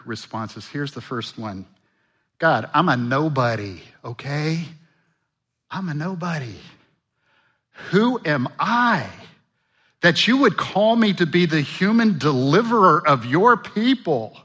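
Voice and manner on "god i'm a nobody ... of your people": a hint of a self-doubting Moses; lightly voiced Moses. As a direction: mildly play Moses shrinking from the call. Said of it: "That's essentially what he's saying".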